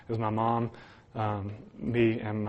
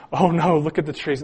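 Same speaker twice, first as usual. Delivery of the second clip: higher, louder panicky cry of oh no; imitated panic; 5 semitones higher, 5 dB louder than usual. Brother watching the tornado from the basement, mock panicked cry. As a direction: play the scared brother, a touch hammed up. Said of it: "so he's going".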